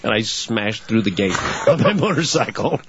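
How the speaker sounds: normal talking voice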